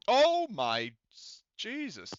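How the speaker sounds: loud, high, drawn-out disbelief; stunned exclamation. Real, not acted. Game master reacting to a huge roll, stunned exclamation. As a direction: blurt it out slowly, high and astonished.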